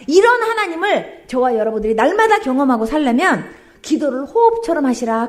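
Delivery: loud preaching call; full-force preaching. Acted. Preacher urging the congregation to pray, loud. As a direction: drive the point home to the crowd.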